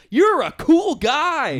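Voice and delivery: very high, loud, drawn-out squeal of praise; mock admiration. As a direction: gush in exaggerated high-pitched awe. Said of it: "And you're just like".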